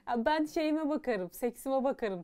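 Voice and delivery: mock indifferent drawl; careless attitude. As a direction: shrug it all off slowly. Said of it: "tarzında".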